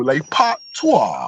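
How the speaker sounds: mock queen's-nephew English, drawn out; exaggerated royal British pronunciation; fancy royal accent. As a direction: imitate a fancy British Patois, very slow. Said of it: "like he was the queen's nephew".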